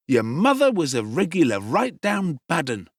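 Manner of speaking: sneering mock pity, stress on mother; jeering affected pity. A narrator's reading. Noah insults Oliver's mother with jeering mock pity. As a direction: twist the knife with fake sorrow. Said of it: "in a jeering tone of affected pity".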